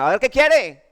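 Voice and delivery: brusque waitress. Retold or imitated. Rude Santander waitress voiced.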